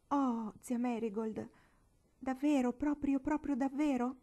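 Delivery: high, slow pleading question; hopeful tearful plea. A narrator's reading. Tearful girl begging her aunt for reassurance, pleading high. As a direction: beg to be told it's true.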